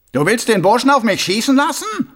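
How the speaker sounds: high, loud mocking voice; a smug killer playing innocent; sneering villain. A narrator's reading. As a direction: Carcer mocking the watchman. Karzer taunts Mumm.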